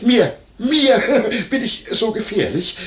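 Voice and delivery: high, incredulous laughing exclamation; laughing protest. Acted. Landlord brushing off Werner's warning, laughing protest.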